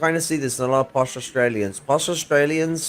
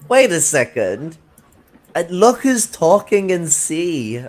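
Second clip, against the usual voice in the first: louder, higher, slow indignant comeback; higher, drawn-out "look who's talking" jab; mock retort; 7 semitones higher, 6 dB louder, slower than usual. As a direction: throw it back at them, slowly and loudly. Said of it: "I'm just like".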